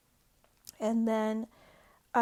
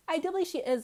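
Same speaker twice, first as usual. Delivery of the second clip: higher and louder, punching believe; higher, louder restatement; 6 semitones higher, 4 dB louder, much faster than usual. Real, not acted. Vlogger repeats her Lisa accusation, higher and louder. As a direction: blurt believe out with conviction.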